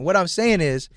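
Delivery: ordinary delivery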